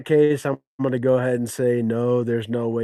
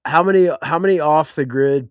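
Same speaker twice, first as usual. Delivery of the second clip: raised, higher voice pushing in to be heard; louder insistent cut-in; 5 semitones higher, 7 dB louder than usual. Real, not acted. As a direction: talk over him to get the question out. Cutting in over the host.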